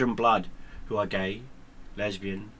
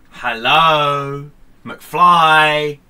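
Hello McFly quote; 4 semitones higher, 12 dB louder, much slower than usual. Retold or imitated. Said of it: "movie quote, loud knock".

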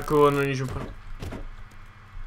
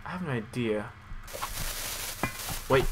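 ordinary talk

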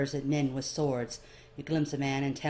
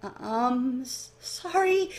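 higher, slow, halting apology; nervous stammer; 8 semitones higher, slower than usual. Acted. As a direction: stumble over the sorry. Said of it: "you stammer, wincing at the nervous tick".

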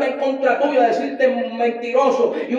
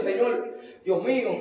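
tearful prayer; 8 dB quieter, slower than usual. Retold or imitated. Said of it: "uno se pone a llorar y uno dice".

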